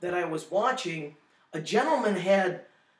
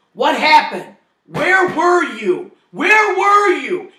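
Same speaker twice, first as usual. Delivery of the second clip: furious, slow, much higher and louder; angry shout; 10 semitones higher, 11 dB louder, slower than usual. Retold or imitated. Wife shouts at the angels, furious and slow. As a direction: yell at the angels, demanding answers. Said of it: "And she was angry".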